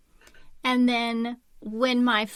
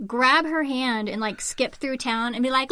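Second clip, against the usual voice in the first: usual speaking voice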